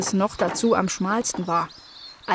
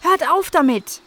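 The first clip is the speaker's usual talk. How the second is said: higher, firm calling out; called out; 8 semitones higher, 4 dB louder than usual. A narrator's reading. Nala calling to the kittens to stop digging. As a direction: stop them sharply. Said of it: "rief Nala Nasebraun".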